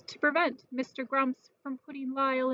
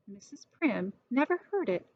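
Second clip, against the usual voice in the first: plain reading voice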